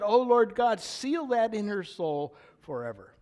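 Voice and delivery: loud, high, impassioned plea; fervent prayer. Real, not acted. Grandpa praying to God for his granddaughter, fervent and loud. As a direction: pray it out with force.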